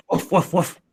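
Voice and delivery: imitated woof woof barks; dog barking. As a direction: bark like a dog. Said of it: "freaking somebody's dog out".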